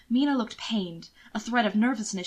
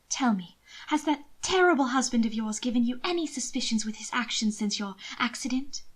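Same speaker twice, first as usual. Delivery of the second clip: lady's mock scolding. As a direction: chide him with affected sweetness. Mina questioning Will.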